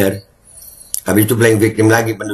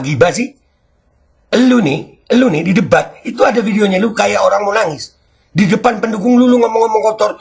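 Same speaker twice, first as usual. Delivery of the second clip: heated rant; 9 semitones higher than usual. Real, not acted. Ranting address to Prabowo. (omelan tinggi dan keras)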